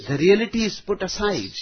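ordinary talk, nothing marked

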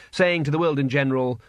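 plain reading voice

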